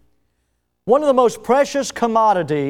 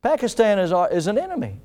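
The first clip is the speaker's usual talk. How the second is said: ordinary talk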